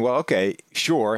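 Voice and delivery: usual speaking voice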